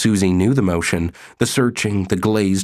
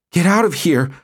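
higher, out-of-breath "get out"; panting yell; 10 semitones higher, 4 dB louder than usual. Acted. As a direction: pant it at the intruding wife. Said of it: "Susie panted".

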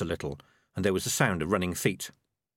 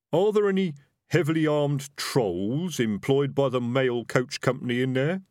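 higher, calling-in rough character voice; highwayman; 6 semitones higher, 4 dB louder than usual. Acted. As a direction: call a suspicious check into the coach.

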